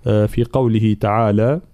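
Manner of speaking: normal talking voice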